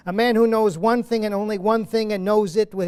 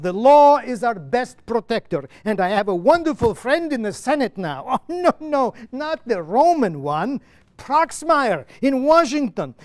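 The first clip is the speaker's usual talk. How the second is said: louder, impassioned play reading; Lemkin's monologue; 4 semitones higher, 6 dB louder than usual. Acted. Lemkin answering Petronius from the play, louder and impassioned. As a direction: proclaim it like a driven man with a cause.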